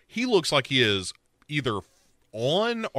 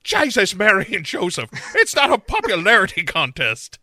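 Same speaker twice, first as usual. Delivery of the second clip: higher, louder mock outburst; flipping out; 5 semitones higher, 5 dB louder than usual. Sarah flipping out on Dylan, mock outburst. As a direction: snap at him in exasperation. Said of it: "Sarah flips out on him".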